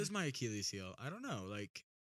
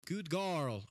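higher-pitched mock Russian; Russian accent; 6 semitones higher than usual. Shown as a demonstration. Showing off an accent to a co-host, mock Russian. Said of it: "I can do Russian".

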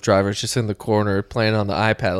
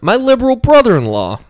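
mocking quote; 11 semitones higher, 6 dB louder than usual. Mocking a post.

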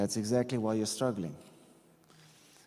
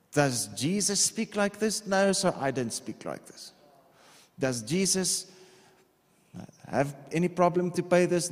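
preaching build; 3 semitones higher than usual. Real, not acted. Call-and-answer preaching build.

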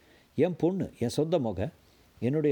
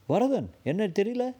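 desperate insistence; 8 semitones higher, slower than usual. Acted. Man to neighbours who don't know him, high and desperate. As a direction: push your name at them, aghast.

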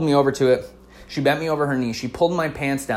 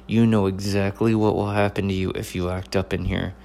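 softer, lower threat spoken through clenched teeth; 5 semitones lower, 5 dB quieter than usual. Retold or imitated. Mom warning her son in a store, through her teeth. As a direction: hiss the warning without opening your mouth. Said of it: "speaking through her teeth".

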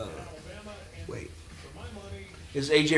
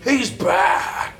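cheering shout; 8 semitones higher, 5 dB louder than usual. Real, not acted. Cheering a player during the game.

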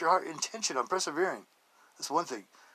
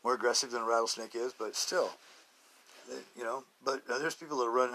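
ordinary talk, nothing marked

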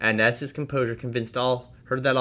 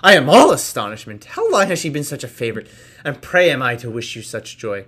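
astonished; 3 semitones higher, 9 dB louder than usual. Acted. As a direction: needle Darcy with shocked delight. Miss Bingley astonished.

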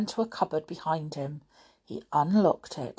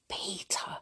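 soft, urgent whispered call; hissed whisper; 4 semitones higher, 10 dB quieter than usual. A narrator's reading. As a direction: get a friend's attention secretly. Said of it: "he hissed".

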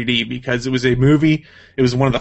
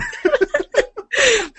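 squealing burst of laughter; real high laugh; high-pitched laugh. Real, not acted. Laughs at rival's comment.

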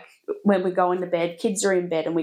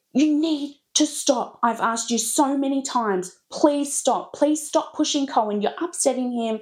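higher, raised mock yell at the kids; retold yelling at child; 7 semitones higher than usual. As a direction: replay the telling-off, pleading but firm. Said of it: "I was yelling at Willa".

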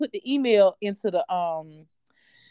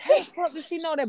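freaked out; 7 semitones higher than usual. Retold or imitated. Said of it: "back then I was like".

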